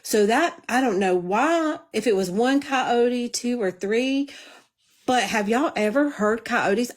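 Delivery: normal talking voice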